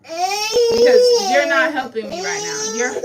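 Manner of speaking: high, slow, loud sobbing yell; crying shout. Real, not acted. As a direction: yell through tears.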